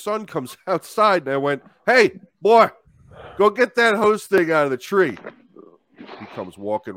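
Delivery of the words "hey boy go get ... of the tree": loud call across the yard; mock bossy shout at the boy. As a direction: yell over to the kid. Calling the boy over.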